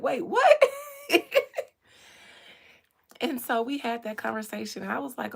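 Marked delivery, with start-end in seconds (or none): 0.0-0.6 high loud laughing disbelief; shocked. Retold or imitated. Shocked millennials. 0.7-1.6 loud squealing laugh; high shrieking laugh. Real, not acted. Laugh after joke.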